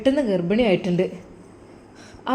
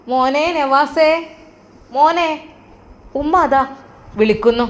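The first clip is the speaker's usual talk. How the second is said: raised call to someone in another room; 4 semitones higher than usual. Acted. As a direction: call him from down the hall. Calling Navas from afar.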